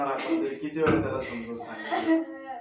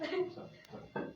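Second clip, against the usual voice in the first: whispered aside; 12 dB quieter, much slower than usual. Real, not acted. Man quietly telling the helper to take her, whispered. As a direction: whisper it, barely voiced.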